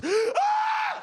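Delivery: very high, startled shriek; mock scream. Woman recognizing man at the mall, screaming. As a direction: shriek as if seeing a ghost.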